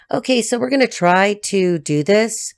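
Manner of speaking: ordinary talk, nothing marked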